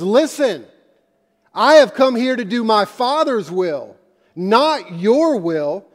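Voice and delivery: forceful rebuke to his mother; firm rebuke. Retold or imitated. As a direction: set her straight, firmly. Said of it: "somewhat rebuking Mary".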